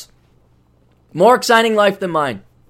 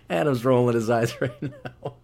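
normal talking voice

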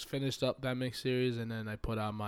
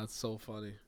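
ordinary delivery

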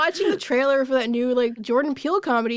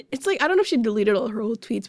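ordinary talk

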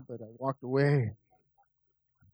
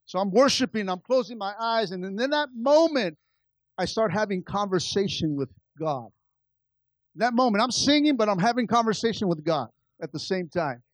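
fervent preaching; 6 semitones higher, 6 dB louder than usual. Acted. Preaching the worship moment.

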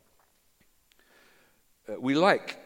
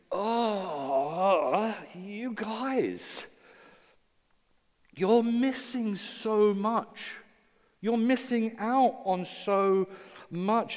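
lamenting; 9 semitones higher, much slower than usual. Pretend. Jesus lamenting the Pharisees.

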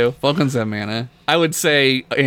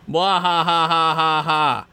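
evil laugh; 3 semitones higher than usual. Acted. Evil laugh reading email.

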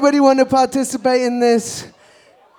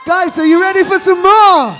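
hype shout; 8 semitones higher, 7 dB louder than usual. Real, not acted. DJ calls out to crowd.

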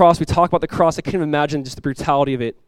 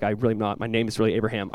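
low, soft retold reassurance; soft denial; 6 semitones lower, 8 dB quieter than usual. Guest assuring an upset student, softly earnest. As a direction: lower and soften it, as if explaining.

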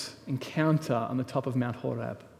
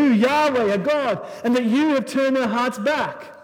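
higher, louder preaching that builds as the story goes; full-force preaching; 5 semitones higher, 6 dB louder than usual. Acted. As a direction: preach it out to the congregation with rising fire. Preaching Elijah showdown at full force.

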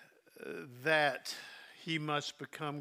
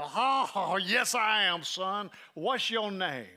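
big man's voice; 5 semitones higher, 6 dB louder, slower than usual. Retold or imitated. Voicing big Jerry Clower.

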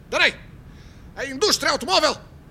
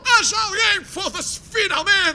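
overjoyed outburst; 5 semitones higher, slower than usual. Acted. Rogério hears the director shares his roots, overjoyed outburst. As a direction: cry out in delighted relief.

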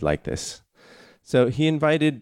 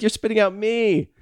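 high mock-offended whine; hammed-up hurt host; 11 semitones higher than usual. Guy retelling friend spitting meat.

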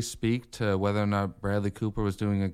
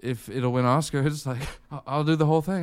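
higher, louder eager grab; snatch the project, hungry for Oscars; greedy Bradley Cooper; 6 semitones higher, 4 dB louder than usual. Retold or imitated. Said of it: "he's like".

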